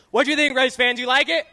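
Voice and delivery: loud, high shout to the fans; calling to the crowd. Real, not acted. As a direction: rouse the grandstand with a question. Driver calls out to the crowd.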